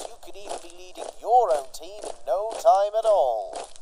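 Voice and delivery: plain reading voice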